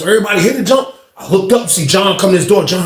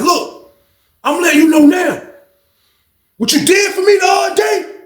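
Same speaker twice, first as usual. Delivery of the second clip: angry shout; 12 semitones higher than usual. Retold or imitated. Inmate warning about the payment.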